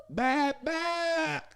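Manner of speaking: high-pitched bleats; imitated lamb bleating. Storyteller mimicking lambs heard from next door, high bleating. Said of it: "So you would just hear".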